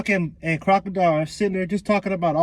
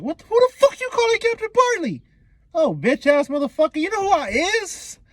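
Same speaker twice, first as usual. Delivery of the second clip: very high, hammed-up indignant screech; mock-furious squawk, pitched way up; outraged yelling; 13 semitones higher, 3 dB louder than usual. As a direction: yell in comic outrage at being misnamed. Buggy outraged at wrong name.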